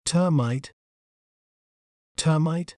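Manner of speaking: "termite" said clearly as TUR-mite; careful pronunciation. Shown as a demonstration. Narrator showing viewers how the insect's name is said, careful pronunciation. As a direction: say "termite" as one careful model word. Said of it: "We may pronounce it as".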